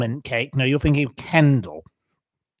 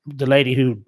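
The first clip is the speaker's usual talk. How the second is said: ordinary talk, nothing marked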